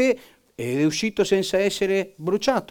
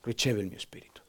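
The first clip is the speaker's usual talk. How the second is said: hushed dying words; 6 semitones lower, 11 dB quieter than usual. Retold or imitated. Stephen's last words.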